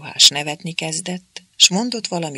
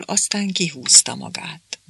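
usual speaking voice

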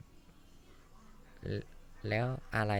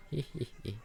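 high sneering giggle; 5 semitones higher than usual. Acted. Bully giggles at victim.

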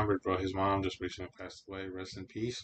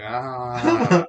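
loud, raised "ah" held out; mock scream; 5 semitones higher, 15 dB louder than usual. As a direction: cry out loudly in mock horror. Mock cry while joking about teacher.

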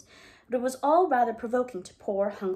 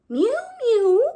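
very high, loud mewing cat; 14 semitones higher, 9 dB louder than usual. Acted. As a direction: mew like a plaintive cat at the door. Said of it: "interrupted Simpkin".

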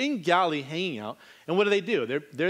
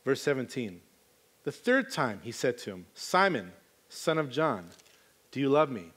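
low, soft Bible reading; quiet reading of the dialogue; scripture reading; 6 semitones lower, 3 dB quieter than usual. Acted. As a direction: read the verses gently. Reading the passage again.

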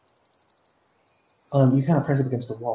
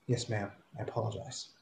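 cowed apology; 10 dB quieter than usual. Acted. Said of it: "you hear the male go".

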